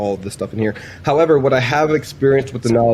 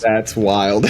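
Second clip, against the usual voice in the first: laughing. Real, not acted. Laughing at AI stuck in loop.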